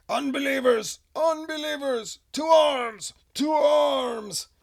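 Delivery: high, booming battle cry; yelling voice. Acted. Mysterious voice from the underwater thing raising the alarm, yelling. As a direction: shout it out as a piercing alarm. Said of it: "a voice yells out from the, from the thing".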